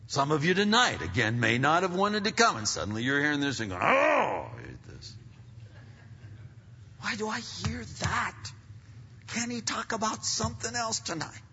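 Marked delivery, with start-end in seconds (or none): 3.8-5.1 mock groan. Acted reluctant churchgoer groaning. 7.0-11.4 high, put-on complaining whine; mock whining. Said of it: "whining and complaining and moaning".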